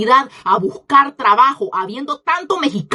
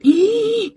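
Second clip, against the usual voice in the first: sharp high gasp of outrage; shocked gasp; 9 semitones higher than usual. Real, not acted. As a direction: gasp as if scandalized. Shocked reaction mid-rant.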